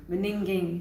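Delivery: Beninging said as be-NING-ging; meme mispronunciation. Retold or imitated. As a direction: say beginning the wrong way. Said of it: "And he says".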